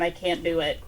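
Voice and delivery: ordinary talk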